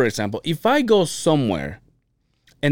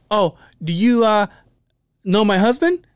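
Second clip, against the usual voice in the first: higher, louder mock wife voice; wife playing matchmaker; imitating wife; 9 semitones higher, 3 dB louder than usual. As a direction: chirp it like the wife greeting a friend. Wife voiced introducing husband.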